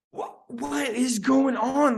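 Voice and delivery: high, slow disbelief; stunned, drawn-out mock alarm; acted bewilderment. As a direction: replay your shock, stretching each word high. Reenacts his shock at mother's session.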